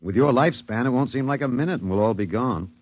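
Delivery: usual speaking voice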